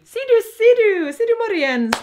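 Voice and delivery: high, loud, slow sing-song tease; looky looky voice. Pretend. Vlogger teasing viewers with a sing-song ser du. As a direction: call it out like a sly street hawker. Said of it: "Det är lite sådär som looky looky".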